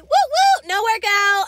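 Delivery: high, loud mock whoop; a high, loud ironic woo; sarcastic cheer. Real, not acted. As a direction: celebrate the skipped workout with a big fake cheer. Mock celebration of skipped workout.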